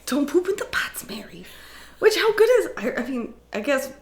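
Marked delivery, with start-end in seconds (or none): 0.1-1.5 mock scolding. Acted scolding of the cook.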